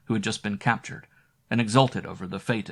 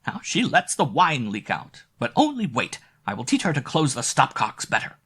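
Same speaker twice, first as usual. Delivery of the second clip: put-on higher, cross old woman; irritated grumble; 5 semitones higher, 4 dB louder than usual. A narrator's reading. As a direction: scold the absent maid. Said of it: "I heard her mutter".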